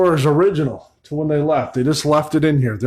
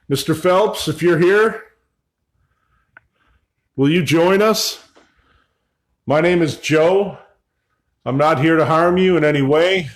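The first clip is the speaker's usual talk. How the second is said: higher, projected voice to an unseen host; calling out; 3 semitones higher, 3 dB louder than usual. Real, not acted. Visitor asking absent Mister Phelps to join him, calling out. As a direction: call out an invitation into the room.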